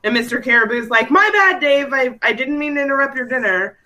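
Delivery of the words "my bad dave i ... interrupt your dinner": sheepish, higher apologetic animal voice; apologetic caribou voice. Retold or imitated. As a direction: say sorry as a meek caribou.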